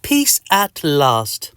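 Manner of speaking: very slow, savoured relief; relieved. Acted. Patient finally free of itching, slow relief. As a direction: stretch it out, satisfied. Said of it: "you say".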